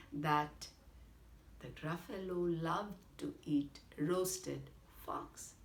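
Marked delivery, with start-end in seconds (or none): none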